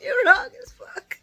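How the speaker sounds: half-laughing, scandalized by the character; laughing disbelief. Retold or imitated. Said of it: "I was like".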